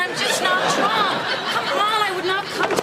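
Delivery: high, heated protest; belligerent. Acted. Lisa arguing she would never turn up drunk, belligerent. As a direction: insist indignantly, pitch pushed right up. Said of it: "Don't get belligerent with me".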